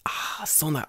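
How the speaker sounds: soft unvoiced whisper; quiet whispered mock. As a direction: breathe it out nervously. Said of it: "He's like".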